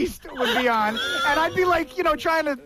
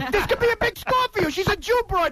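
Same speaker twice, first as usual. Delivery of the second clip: higher pleading Pesci hustle; Joe Pesci voice; 5 semitones higher than usual. Retold or imitated. Acting out Goodfellas with his friend, a pleading Pesci voice. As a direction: wheedle Henry like Pesci in Goodfellas. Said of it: "the only way I can do a good Joe Pesci".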